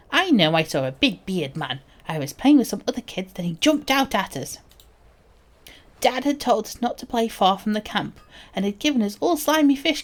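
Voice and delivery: put-on lively, childlike storytelling voice; animated kid character reading aloud; child reading a note. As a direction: tell it like an excited little creature.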